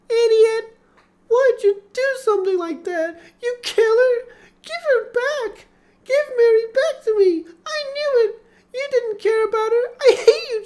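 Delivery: high, very loud sobbing rage; furious crying scream. A narrator's reading. Laura screams hatred at James while punching him, sobbing. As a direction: scream and weep at him, hitting hard.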